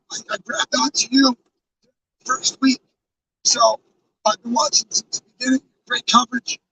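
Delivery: normal talking voice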